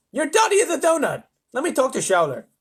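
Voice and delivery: loud, high, exasperated lisp; indignant lisping leader; lisping President. A narrator's reading. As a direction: declare him crazy with a lisp. President insults astronaut.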